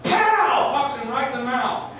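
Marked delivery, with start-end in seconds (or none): 0.0-0.7 loud high pow; mock smack; slap sound effect. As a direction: punch out the slap noise. Imitating an open-palm slap.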